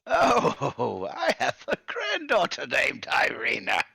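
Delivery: high, loud, doddery delight; confused old man, loudly thrilled; delighted senile grandfather. Acted. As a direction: exclaim it as a senile old man pleased by a coincidence. Old man greets a namesake.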